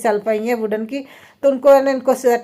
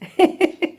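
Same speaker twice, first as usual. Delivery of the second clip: laugh; 8 semitones higher than usual. Real, not acted. Laughing at husband's burnt finger.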